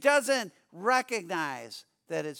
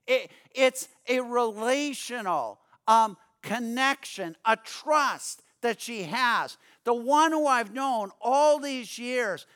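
impassioned preaching; 5 semitones higher, 5 dB louder than usual. Acted.

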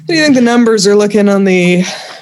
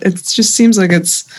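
usual speaking voice

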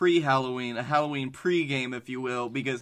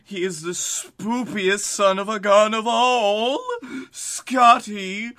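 slow booming emcee voice; announcer; 6 semitones higher, 5 dB louder, slower than usual. Acted. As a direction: roll the name out big. Co-host answers in announcer voice.

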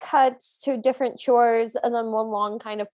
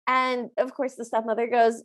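normal talking voice

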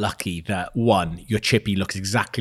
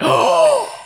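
sharp gasp; 4 dB louder than usual. Retold or imitated. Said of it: "We were just looking at each other like".

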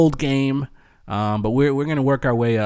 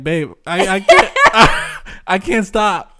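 retold plea broken by real laughter, slower; can't get the words out for laughing; laughing helplessly; 6 semitones higher, 6 dB louder, slower than usual. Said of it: "And I was like".

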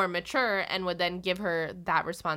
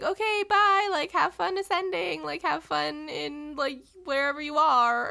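higher, breezy imitated goodbye; cheery send-off; 7 semitones higher, 5 dB louder than usual. She sends him off to ascend, cheery imitation. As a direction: mimic her cheerfully waving someone off, pitched up. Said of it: "she's like".

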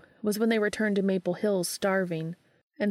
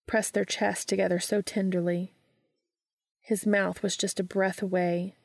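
ordinary delivery, nothing marked

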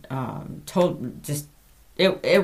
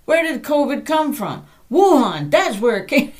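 louder, higher mock rant; going off on her; 8 semitones higher, 6 dB louder than usual. Telling off the scam caller, loud and heated. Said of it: "I went off on her".

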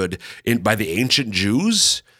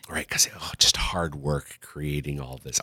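mock tired whisper; 7 semitones lower, 8 dB quieter than usual. Mock weary God whisper.

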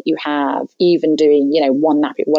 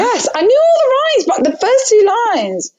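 much higher, louder exclaim; excited exclaim; 3 dB louder than usual. Real, not acted. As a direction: exclaim in mock frustration. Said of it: "laughing frustration".

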